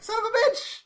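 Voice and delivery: hammed-up furious director voice, pitched high. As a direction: ham up the director's rage, high. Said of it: "And I'm like".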